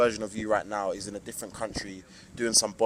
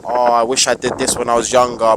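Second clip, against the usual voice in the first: mock regret; 9 dB louder than usual. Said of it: "where you're like".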